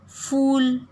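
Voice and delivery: "फूल" का ऊ खींचकर ऊंचा बोलें; "फूल" में लंबा, ऊंचा ऊ दिखाएं; long oo demo. Shown as a demonstration. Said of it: "बड़ी ऊ की मात्रा में". (बड़ी ऊ का लंबा उच्चारण)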